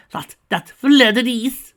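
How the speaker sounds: high, loud and slow, dragging the name out; outraged diva. Acted. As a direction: spit the rival's name out slowly. Rarity furious about husband's friend.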